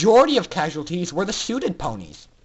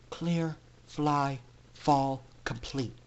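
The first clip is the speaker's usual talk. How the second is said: whispered; 5 semitones lower, 8 dB quieter, much slower than usual. A narrator's reading. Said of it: "she whispered to Aurora".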